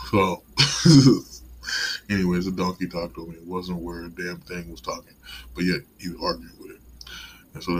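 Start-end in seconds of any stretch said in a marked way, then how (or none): 0.5-2.0 a high, loud burst of real laughter. Real, not acted. Vlogger laughing at his own rant to viewers, loud laugh. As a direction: cackle at your own joke.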